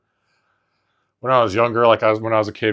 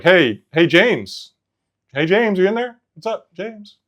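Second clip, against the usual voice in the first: higher, bright, easy calling of James; casual call; 8 semitones higher, 3 dB louder, slower than usual. Shown as a demonstration. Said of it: "the way I would ask".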